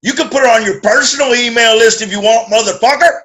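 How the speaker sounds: loud, snapping wife on the phone; angry wife. Retold or imitated. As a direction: bite it off, furious. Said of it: "And she said".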